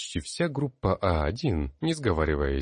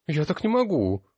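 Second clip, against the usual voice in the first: high, louder lament; wailing; 10 semitones higher, 4 dB louder than usual. A narrator's reading. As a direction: wail it in despair. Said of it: "запричитала одна из девушек". (высокий жалобный причитающий голос)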